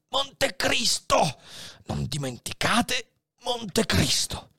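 a final effort, all his strength; last urgent cry. Acted. Dying Faria's final plea to Edmond, urgent. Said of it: "con un ultimo sforzo".

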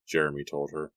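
usual speaking voice

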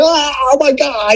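high, frantic mock panic, imitating his wife; retelling his wife losing it in the car, high panicked cry; panicked shriek. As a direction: squeal it like a terrified passenger. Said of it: "my wife's, like, lost her shit".

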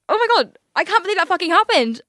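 high, loud mock horror; retold mortified exclamation. Retelling her mortified reaction to vomiting.

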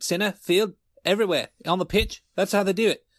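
usual speaking voice

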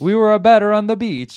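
high, loud mock Italian accent. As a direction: belt it out in a hammed-up Italian accent. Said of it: "talk in an Italian accent".

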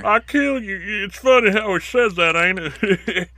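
high, slow puppet voice; a comedian's puppet voice done high and slow; Jeff Dunham puppet. Retold or imitated. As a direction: do the ventriloquist puppet's threat. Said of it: "Jeff Dunham telling a joke".

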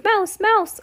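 very high little cry; mock shouts. A narrator's reading. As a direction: squeal it out like someone spotting a mouse. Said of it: "there were no shouts of".

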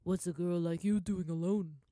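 loud, rough seedy merchant voice; a suspicious merchant looming over her; gruff merchant. A narrator's reading. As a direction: merchant questioning a lone girl, loud. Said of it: "one of them asked".

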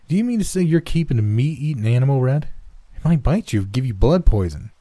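high-pitched motherly alarm; lifted, fretful questioning; worried mother. A narrator's reading.

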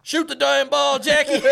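high, loud mock yell; movie quote yell. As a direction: shout it at the player. Quoting movie line.

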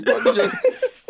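breaking into a breathy laugh. Real, not acted. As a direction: laugh through the words. Dissolves into laughter.

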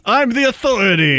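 high mock-British proclamation; hammed-up British accent; super British. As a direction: declare it like a pompous British know-it-all. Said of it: "He's super British".

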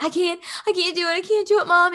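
high, quick imitation of a panicking child; mock freaking out. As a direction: plead like a kid losing it. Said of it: "he's freaking out".

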